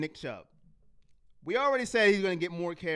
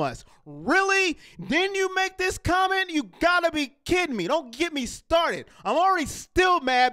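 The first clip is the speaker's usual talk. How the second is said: angry rant; 7 semitones higher than usual. Real, not acted. Angry fan ranting at the player about his comment. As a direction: let him have it, outraged. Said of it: "getting me upset and angry".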